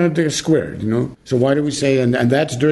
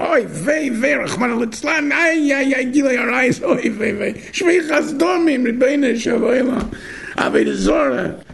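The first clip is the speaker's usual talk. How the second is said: high wailing oy vey lament; mock kvetching Yiddish wail; yiddish accent; 10 semitones higher than usual. Mock lament over sins.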